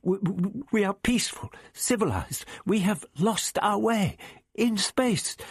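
timid stammered appeal; anxious faltering plea. A narrator's reading. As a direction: plead nervously, then halt lamely. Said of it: "Barbara halted lamely".

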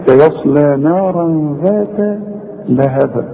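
slow recitation, lightly bounced ب ending لهب; light qalqalah. Shown as a demonstration. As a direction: finish لهب with a small echo on the b. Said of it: "شوف البه القلقلة خفيفة إزاي؟".

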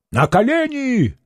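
high-pitched angry cry; irate governor's high, drawn command. A narrator's reading. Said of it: "гневно крикнул воевода".

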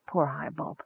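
very soft, hushed dream voice; whispering. A narrator's reading. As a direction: murmur it very quietly in sympathy. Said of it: "the voice whispered".

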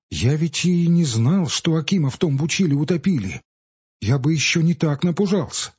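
lightly voiced frightened village boy; a hint of a scared boy; boy's voice. A narrator's reading. Kostya tells his fright.

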